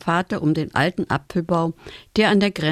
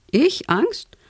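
high, rising incredulous questions; 10 semitones higher than usual. A narrator's reading. Father asked if he is afraid, incredulous. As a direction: throw it back in disbelief, pitched up.

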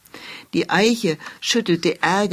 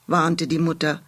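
usual speaking voice

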